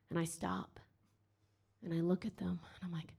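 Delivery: hushed, unvoiced build-up; whisper. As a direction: whisper it like a secret. Building tension, whispered storytelling.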